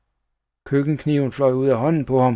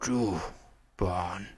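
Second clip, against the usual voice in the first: soft, low, halting rasp; hoarse dying voice; 4 semitones lower, 8 dB quieter than usual. A narrator's reading. Poisoned Schnickels accusing the child, hoarse voice. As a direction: force out each word hoarsely, with gaps. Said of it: "sagde han hæst".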